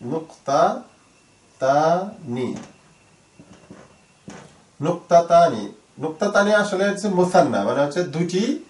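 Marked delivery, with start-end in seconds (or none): 0.1-2.6 very slow, with long gaps between syllables. Shown as a demonstration. Teacher introducing the Arabic for colon, slowly syllable by syllable. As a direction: spell out "নুক্তাতানি" by ear, one piece at a time.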